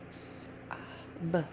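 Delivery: S, uh, b whispered faintly with gaps; separate letter sounds. Shown as a demonstration. Teacher prompting a reader, faint separate sounds. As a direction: softly sound out S, uh, b one at a time. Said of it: "Start saying the sounds".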